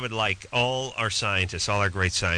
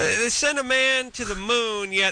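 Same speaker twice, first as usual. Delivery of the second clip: mock outrage; 8 semitones higher than usual. Real, not acted. Mock outrage at missing invention.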